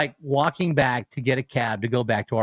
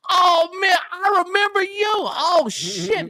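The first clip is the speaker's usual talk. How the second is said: much higher, gushing mock stranger voice; amazed stranger; 12 semitones higher than usual. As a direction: rave up high like a starstruck fan.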